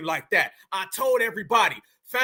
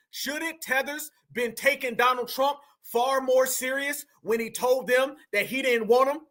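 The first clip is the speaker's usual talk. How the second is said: ordinary talk, nothing marked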